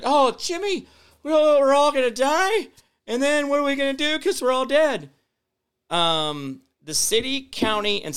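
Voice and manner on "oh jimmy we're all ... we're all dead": high, loud whiny panic voice; mock the viewers as hysterical doomsayers; mock panicked viewer. Mocking viewers who think defunding means doom, high whiny panic. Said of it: "you guys think it's like".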